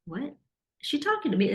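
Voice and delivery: high, incredulous question; can't believe she means you; surprised disbelief. Retold or imitated. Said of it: "And I'm like".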